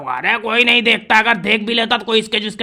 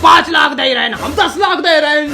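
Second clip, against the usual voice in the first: building louder and higher; escalating shouting; 6 semitones higher, 5 dB louder than usual. Acted. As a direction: outbid them, getting louder. Escalating bidding war.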